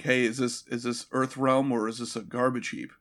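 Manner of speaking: lightly acted quip, low and soft; offhand joke. Raiden trying a kinda funny joke, offhand and quiet. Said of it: "say something that's, like, kinda funny".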